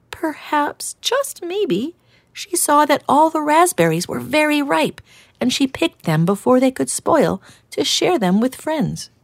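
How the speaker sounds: timid hedgehog offering an idea; gentle hedgehog. Acted. Hedgehog explains.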